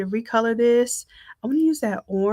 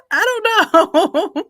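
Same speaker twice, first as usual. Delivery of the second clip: laughing it out, a bit higher and louder; 8 semitones higher, 8 dB louder, slower than usual. Real, not acted. Host laughing at her own doubt about the photo, laughing. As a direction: giggle through the words, unsure.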